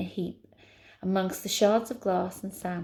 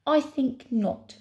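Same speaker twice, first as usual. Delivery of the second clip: high, drawn-out refusal; haughty refusal; 6 semitones higher, 3 dB louder, slower than usual. Acted. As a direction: refuse him grandly, stretching it.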